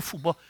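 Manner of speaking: "futebol" as "fubol", said softly; imitated American pronunciation. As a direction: quote "futebol" without its middle "te". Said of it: "ela falou futebol".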